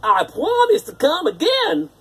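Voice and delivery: prince promising. A narrator's reading. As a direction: promise it earnestly. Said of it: "he said".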